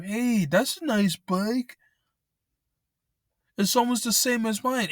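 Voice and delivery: butter him up like a would-be thief; sly scammer. Retold or imitated. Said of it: "talking to him".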